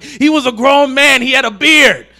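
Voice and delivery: fed-up grandpa. Retold or imitated. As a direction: throw it out like a grandpa at the end of his rope. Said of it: "his grandpa gets fed up too".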